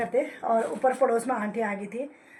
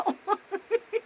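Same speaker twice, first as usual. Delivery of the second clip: a high, helpless giggle; 10 semitones higher than usual. Real, not acted. Wife laughing about husband's burnt moustache, high giggle. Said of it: "इतनी हंसी आ गई".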